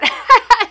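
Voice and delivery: loud high burst of laughter; relieved laugh. Real, not acted. Mother laughing off the rough night, loud laugh.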